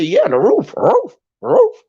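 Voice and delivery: high yapped "roof, roof"; dog bark. Acted. Answering "is it stand under", barking "roof" high like a dog. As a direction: leap up in pitch and bark each repeated "roof". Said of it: "The dog barks like roof".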